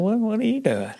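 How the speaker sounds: high, lilting question; quoted question, swooping high; phone banter. Retold or imitated. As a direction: pitch it way up, then drop.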